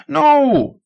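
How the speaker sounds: high, loud cry; old man cries out as the young man runs off; desperate cry. Acted. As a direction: cry out in protest.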